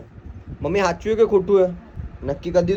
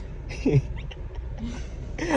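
soft, amused laughing fit; 7 semitones higher, 10 dB quieter than usual. Real, not acted. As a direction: laugh quietly at the tease. Laughing during teasing exchange.

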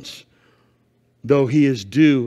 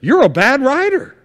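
much higher, loud hurt donkey voice; indignant talking donkey; 13 semitones higher, 7 dB louder, faster than usual. Acted. The beaten donkey scolding Balaam, high and loud. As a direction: squeal it like a wronged donkey. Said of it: "He goes".

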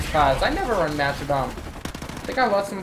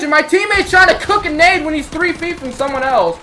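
loud, high-pitched outrage; angry shout; 11 semitones higher, 8 dB louder than usual. Real, not acted. Gamer complaining about a teammate's grenade blunder, angry shout. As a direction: rant at full volume.

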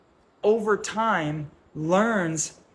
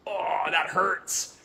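slow, softer mock ouch; mock pained foot; 4 dB quieter, much slower than usual. A foot stepping on a sharp point, slow mock wince. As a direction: voice a foot wincing on a sharp rock. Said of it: "it goes".

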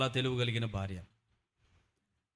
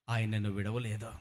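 ordinary delivery

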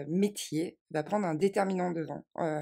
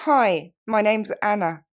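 slow, clear, louder English model; slow model phrase; 2 semitones higher, 9 dB louder than usual. Shown as a demonstration. Teacher modelling an introduction slowly. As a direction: say it slowly for learners. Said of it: "Ou encore".

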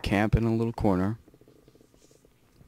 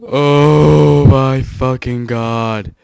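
anguished shout; 4 semitones higher, 15 dB louder, much slower than usual. Real, not acted. Dies at the very end.

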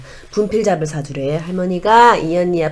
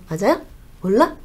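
normal talking voice

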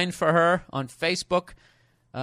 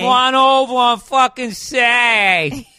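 loud, slow, higher Boston accent, "Saturday" with no consonants; 6 semitones higher, 9 dB louder, slower than usual. Shown as a demonstration. Host mocking Boston speech for his cohost, thick Boston accent. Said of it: "as they say in Boston".

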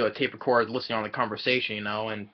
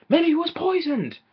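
high, put-on voice for a worried guess; mock alarmed suggestion; 4 dB louder than usual. Professor Plum suggesting poison in a high put-on voice. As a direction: squeak out the theory.

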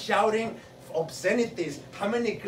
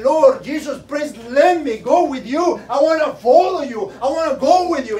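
begging quote; 4 semitones higher, 8 dB louder than usual. Retold or imitated. Man begging to follow Jesus.

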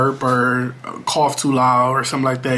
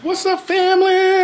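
high greeting; 3 dB louder than usual. Real, not acted. High sing-song vlog greeting.

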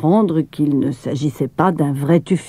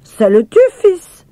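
put-on smiling boy; 12 semitones higher, 5 dB louder, slower than usual. A narrator's reading. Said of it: "expliqua Émile en souriant".

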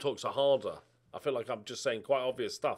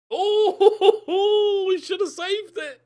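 laughing; 12 dB louder, much slower than usual. Real, not acted. Laughing at the miss.

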